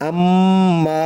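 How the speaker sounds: আম্মা with the mm held long through the nose; long nasal hum on আম্মা; nasal ghunnah. Shown as a demonstration. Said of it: "নাকের বাসি হইতে গুন্নাহ পড়া হয়".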